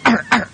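two high, gruff mock barks; imitated dog bark. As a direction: bark like a guard dog, twice. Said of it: "My dog runs up and goes".

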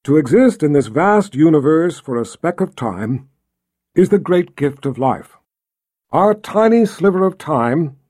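measured, projected oratory; solemn, stately public speech; voice all can hear. A narrator's reading. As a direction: address the gathered thousands with conviction. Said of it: "in a voice that all could hear".

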